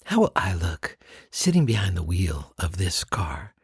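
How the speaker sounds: whispered daydream behind the wheel; whispered inner thought. Retold or imitated. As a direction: whisper it to yourself, dreaming. Imagined inner thought of car buyer.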